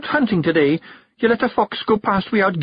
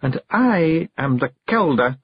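slow, higher, commanding interruption; hard, cold, sharp; 7 semitones higher, slower than usual. A narrator's reading. The Kelder overrules her husband, cold and sharp. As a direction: cut in icily, taking your time. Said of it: "hard, cold, sharp".

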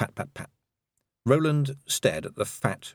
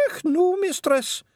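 high, slow, nervous Scots pixie; slower than usual. A narrator's reading. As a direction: protest timidly as a wee Feegle.

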